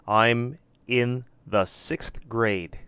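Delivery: slow, clear model answer for students. Shown as a demonstration. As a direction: spell it out slowly like a teacher. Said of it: "They should answer it like this".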